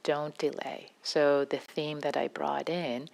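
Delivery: ordinary delivery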